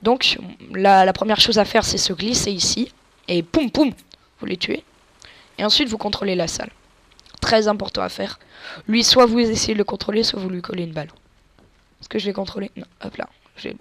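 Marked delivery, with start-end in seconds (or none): none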